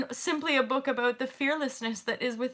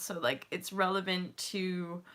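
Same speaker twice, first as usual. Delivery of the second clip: usual speaking voice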